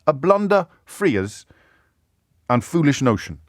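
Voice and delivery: a light Scottish lilt, reading Burns; half-hearted Scottish accent on a Burns poem; reading Rabbie Burns with a hint of Scottish accent. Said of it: "and I won't do the accent".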